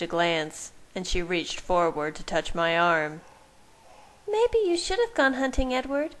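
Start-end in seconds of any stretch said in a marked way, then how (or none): none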